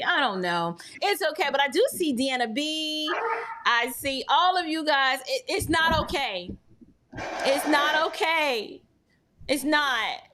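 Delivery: ordinary delivery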